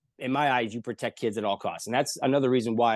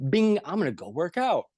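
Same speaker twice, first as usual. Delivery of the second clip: higher, lightly hammed-up eagerness; mock perky riser; 6 semitones higher than usual. People waking up ready to work out, mock perky. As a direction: pop awake with mock enthusiasm.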